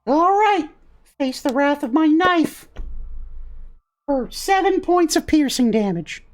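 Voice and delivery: high little fighter's voice; feisty gingerbread boy. Acted. Gingerbread boy attacking with his knife, feisty. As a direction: pipe it up as a cookie warrior.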